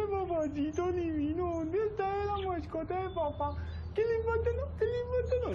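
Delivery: high, soft cooing to a pet; baby talk. Acted. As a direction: coo at the little pet.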